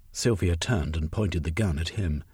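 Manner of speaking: ordinary delivery, nothing marked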